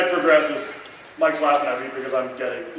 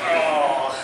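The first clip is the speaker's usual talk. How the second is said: ordinary talk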